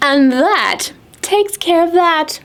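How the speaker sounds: satisfied squirrel. Acted. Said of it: "said old Mama Squirrel".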